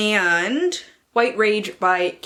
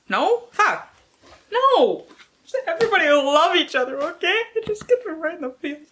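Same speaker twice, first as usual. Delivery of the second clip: much higher, louder emotional protest; excited outburst; 10 semitones higher, 4 dB louder than usual. Acted. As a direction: cry out in mock distress. Reacting to sad blurb.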